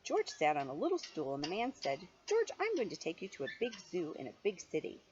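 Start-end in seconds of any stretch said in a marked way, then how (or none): none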